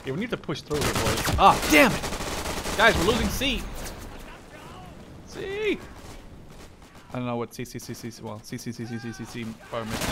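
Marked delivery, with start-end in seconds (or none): 1.4-2.8 drawn-out slow curse, louder; frustrated groan. Real, not acted. Frustrated gamer losing objective. 2.8-5.8 alarmed callout. Real, not acted.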